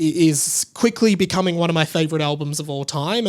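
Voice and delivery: ordinary delivery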